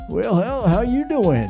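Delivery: high, loud voice for himself; hammed-up chirpy greeting; high mock-casual voice. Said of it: "and I was like".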